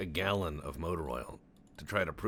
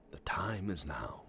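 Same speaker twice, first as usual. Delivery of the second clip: hushed dramatic whisper, slow; an ominous slow whisper; 4 dB quieter, slower than usual. Shown as a demonstration. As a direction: breathe the line out in a slow whisper.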